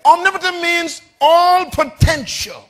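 loud, high, emphatic preacher's proclamation; full-force preaching. Real, not acted. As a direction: proclaim it to the whole congregation at full voice.

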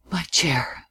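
soft, whispered aside; to himself. A narrator's reading. Man sinking wearily into a chair, to himself. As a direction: murmur it privately under the breath. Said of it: "as if to himself".

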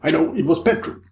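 excited guess, voice lifted; crying out. A narrator's reading. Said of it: "cried Ricardo".

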